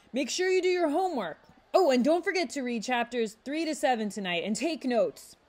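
high, loud teacher call over the class; exclaimed. A narrator's reading. Teacher calling after students leaving class, high and loud. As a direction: call it out as the bell rings. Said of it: "the teacher exclaimed".

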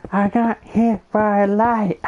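high, slow character voice; high-pitched playful joke voice; put-on silly voice. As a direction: pitch it way up and draw it out. Carries on the silly voice.

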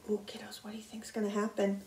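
quick, low whisper; soft conspiratorial aside; hushed suspense. Pretend. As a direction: whisper it to the children as a secret. Suspenseful aside to child listeners.

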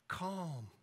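ordinary talk, nothing marked

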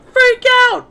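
high shriek of panic; mock freak out. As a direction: yelp it like a panicking NPC. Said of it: "That's not how freak out goes".

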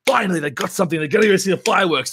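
high, loud hype; excited imagined reaction. Retold or imitated. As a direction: play up the viewer's thrilled relief. Voicing the excited viewer's reaction.

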